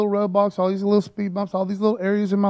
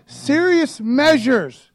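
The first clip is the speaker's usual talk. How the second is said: serious measures drawn out, higher and louder; slow emphasis; 5 semitones higher, 6 dB louder, much slower than usual. Acted. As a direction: hammer home serious measures slowly. Preacher stresses the point.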